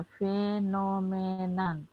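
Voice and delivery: ফেনোমেনান spelled out very slowly, each of its four syllables separate. Shown as a demonstration. Teacher spelling out the new term ফেনোমেনান for students, very slow. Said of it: "চারটা syllable আছে".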